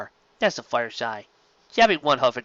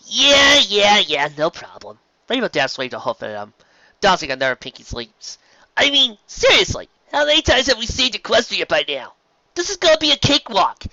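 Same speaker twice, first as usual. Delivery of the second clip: much higher, louder brash tomboy voice; Rainbow Dash cocky; 8 semitones higher, 6 dB louder than usual. Acted. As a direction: brush it off like a cocky flyer.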